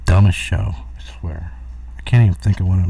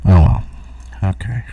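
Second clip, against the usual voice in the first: normal talking voice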